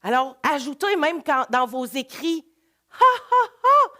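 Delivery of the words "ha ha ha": a loud, high ha ha ha; demo laugh. Shown as a demonstration. Showing students how a character laughs, a loud high ha ha. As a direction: burst out a bright written-style laugh. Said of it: "ria-t-elle".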